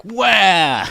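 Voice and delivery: loud fake crying wail; hammed-up kid wail; mock baby wail. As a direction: bawl like a toddler left at school. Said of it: "So I'm like".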